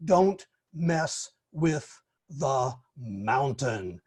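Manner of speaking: slow, low, weighty delivery of the punchline; dramatic closing line. Retold or imitated. Evaluator quoting the speaker's strong ending, slow, low and dramatic. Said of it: "effective ending".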